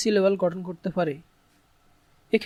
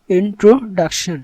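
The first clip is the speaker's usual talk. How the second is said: "Introduction" loud and slow, clear gaps between parts; syllable by syllable; 7 dB louder, much slower than usual. Shown as a demonstration. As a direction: drive home each syllable of "introduction". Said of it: "এগুলোকে ভাগ করব".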